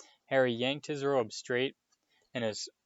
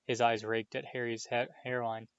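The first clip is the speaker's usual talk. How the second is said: plain reading voice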